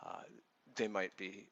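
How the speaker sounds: ordinary talk, nothing marked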